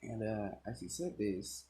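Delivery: plain reading voice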